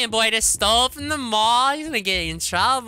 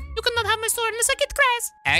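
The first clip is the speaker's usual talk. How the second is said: much higher squeaky pretend voice; high pretend critter wanting grass; cutesy character voice; 14 semitones higher than usual. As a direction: play a tiny begging animal. Voicing character bargaining for grass.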